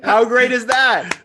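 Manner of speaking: high, drawn-out cheer; thrilled for a friend; excited delight. Real, not acted. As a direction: exclaim slowly, pitch way up. Cheering as the message ends.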